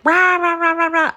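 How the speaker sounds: high, loud mocking rah-rah; hammed-up angry babble; mock out-of-control rant. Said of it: "out of, out of control".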